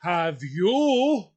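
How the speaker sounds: hammed-up indignant outburst; mock upset Frenchman. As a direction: imitate an appalled French driver, word by word. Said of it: "exactly what French people do".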